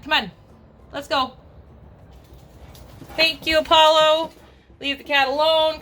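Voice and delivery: high coaxing call to the dogs; high sing-song dog call. Real, not acted.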